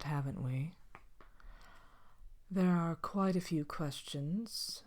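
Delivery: haughty, softly spoken queen, a touch vain and bored; a vain royal granting an audience, quiet and superior; Evil Queen. Acted. Evil Queen hosts a talk show.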